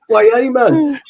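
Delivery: mock Geordie accent. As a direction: do a high-pitched Geordie catchphrase. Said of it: "Something like that".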